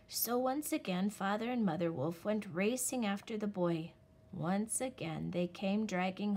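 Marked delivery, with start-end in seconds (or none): none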